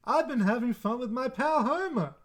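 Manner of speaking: high, sing-song neighborly voice; chirpy fake-friendly Ned impression; Ned Flanders voice. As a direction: Homer faking Ned's voice for Maude. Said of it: "does, like, the Ned Flanders voice".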